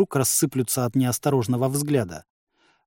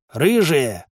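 moderately raised, higher-pitched shout; calling out; 5 semitones higher, 4 dB louder, slower than usual. A narrator's reading. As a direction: call an order across the camp, not full volume. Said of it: "крикнул Бурборыч".

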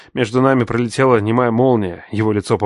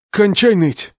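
much higher, louder curt shout; snapped order; 11 semitones higher, 5 dB louder, slower than usual. A narrator's reading. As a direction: fling it at him. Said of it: "бросил отец".

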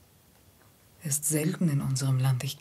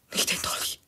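hushed, frightened whisper; fearful plea. Acted. Woman begging her guest in a frightened whisper to put the dagger away. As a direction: beg him in a scared whisper.

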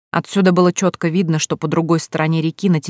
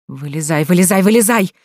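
rapid urgent patter. A narrator's reading. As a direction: rattle it off in alarm. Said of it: "затараторил он".

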